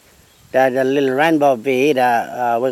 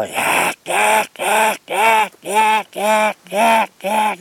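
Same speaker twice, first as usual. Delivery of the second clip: high repeated squawks; 7 semitones higher, much slower than usual. Retold or imitated. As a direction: squawk rhythmically like a cockatoo in flight. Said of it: "when he flying".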